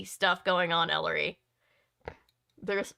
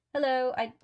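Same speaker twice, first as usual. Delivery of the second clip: higher put-on English accent for Fiona; bad English accent; 5 semitones higher, 3 dB louder than usual. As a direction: try a vaguely English accent, badly. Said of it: "here's my bad Fiona Fox accent".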